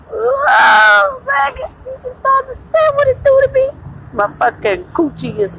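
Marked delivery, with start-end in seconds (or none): 0.1-3.7 high, crying shout of excitement; overwhelmed outcry. Real, not acted. Gushing to her viewers about two men, crying out overwhelmed. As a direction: cry it out, overwhelmed with desire.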